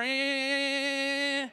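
imitated electric buzz of a toy football game. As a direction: make the toy's flat mechanical hum. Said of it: "the little men are".

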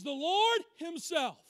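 "Lord" drawn out and punched; emphatic. Shown as a demonstration. Preacher explaining who comes in person, emphatic. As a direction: stretch "Lord", then land firmly on "Himself". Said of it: "It's emphatic".

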